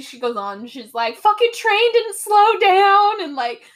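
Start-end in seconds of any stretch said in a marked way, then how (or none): 1.2-3.2 high, loud, drawn-out yell; retold scream. Veronica melting down in the desert, retold scream. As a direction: yell it out in mock meltdown, stretching the words. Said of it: "And she screams".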